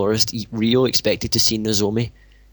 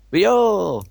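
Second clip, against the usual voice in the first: calling out; 10 semitones higher, 4 dB louder than usual. Acted. Hot dog vendor calling out.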